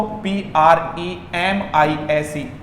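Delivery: slow letter by letter; spelling out letters. Shown as a demonstration. Teacher spelling the word slowly for students. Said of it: "ध्यान देना दोस्तों".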